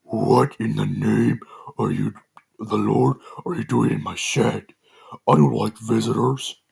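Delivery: slow, menacing demonic rasp, half whispered. A narrator's reading. Masked figure threatening Joe in his shed, demonic voice. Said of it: "a voice that sounded low-pitched and demonic".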